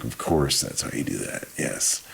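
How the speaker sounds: breathy whisper of realization. Retold or imitated. Remembering watching Joe play, whispering yes to himself. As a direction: whisper it like a private discovery. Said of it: "I was like".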